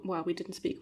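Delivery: ordinary talk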